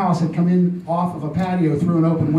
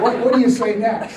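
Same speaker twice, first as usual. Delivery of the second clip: ordinary talk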